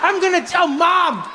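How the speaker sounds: high, wailing mock outrage; tearful accusing child. Son blaming dad who left him, high tearful accusation. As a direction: whine it like a betrayed little boy. Crying boy accuses his father.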